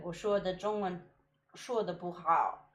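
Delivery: put-on halting Mandarin with an American accent; foreigner speaking mandarin. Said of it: "And so I said".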